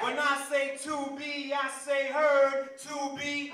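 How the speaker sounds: rallying crowd chant lead-in; call-and-response. Acted. Poet warming up the crowd, rallying call-and-response.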